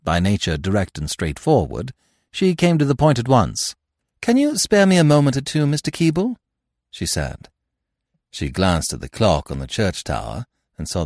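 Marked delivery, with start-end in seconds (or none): 4.2-6.3 put-on young woman. A narrator's reading.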